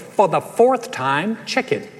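slow, exasperated wife; acted annoyance, dropping on the last word; very annoyed. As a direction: draw it out with weary irritation. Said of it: "in a very annoyed voice".

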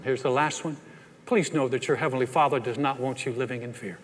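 ordinary delivery

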